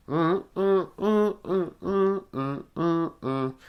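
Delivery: high, loud grunts on the beat; repeated short "mm" bobbing to the track; rhythmic grunting. Retold or imitated. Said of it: "Just going like".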